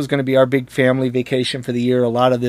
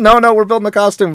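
mock protest; 8 semitones higher, 5 dB louder, faster than usual. Protesting to puzzled store employee.

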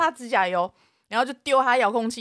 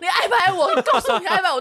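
frantic melodrama lover, high and loud; 马景涛; 9 semitones higher, 4 dB louder, faster than usual. Acted. Parody of a Chiung Yao drama lover, frantic pleading. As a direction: plead hysterically, escalating in pitch. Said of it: "马景涛就是一个很疯狂的人啊".

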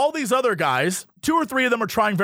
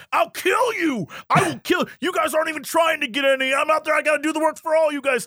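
higher, shouted mock threats, rushing at the end; 5 semitones higher than usual. Hothead yelling threats at the guys in the house.

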